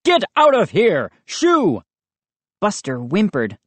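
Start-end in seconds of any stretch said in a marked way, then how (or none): 0.0-1.8 high-pitched furious shooing; angry shout. A narrator's reading. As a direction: chase the dog off, pitch climbing. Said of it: "he shouted, waving his arms wildly".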